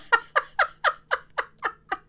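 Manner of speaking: a high, breathless giggle. Real, not acted. Laughing about her own child, high giggle. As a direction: let a high giggle burst out.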